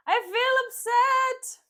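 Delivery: high, loud, slow mock wail on upset; exaggerated upset. Shown as a demonstration. Reader hamming up her own upset, high, loud and slow. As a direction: wail it out like an overdone drama queen.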